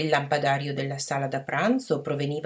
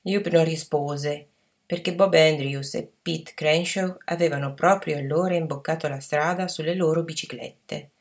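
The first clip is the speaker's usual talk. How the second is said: ordinary delivery, nothing marked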